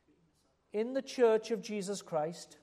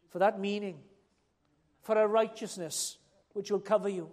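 ordinary talk

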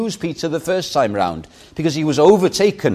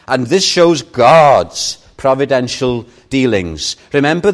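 normal talking voice

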